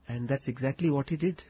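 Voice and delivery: normal talking voice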